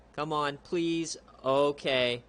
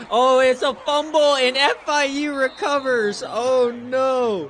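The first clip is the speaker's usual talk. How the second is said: much higher, much louder cry of disbelief; shocked shout; 11 semitones higher, 12 dB louder, slower than usual. Real, not acted. Gamer shouting at his own team's fumble, shocked and loud. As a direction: yell as the fumble goes the wrong way.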